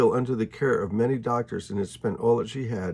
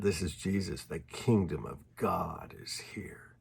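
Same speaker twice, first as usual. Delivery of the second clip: slow, reverent whisper; a whispered, awestruck proclamation; awed whisper; 5 semitones lower, 9 dB quieter, slower than usual. Real, not acted. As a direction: let it land slowly, hushed. Preacher's climactic closing statement.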